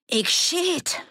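very high, strained cry; desperate high-pitched threat; panicked threat. A narrator's reading. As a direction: warn them off at gunpoint. Threat with a gun aimed.

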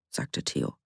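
ordinary delivery, nothing marked

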